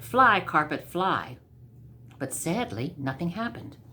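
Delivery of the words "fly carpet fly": ordinary delivery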